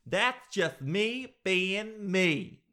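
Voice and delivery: mock defiance. Library loudmouth answers the shush, mock-defiant. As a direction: stretch it out, high and unrepentant.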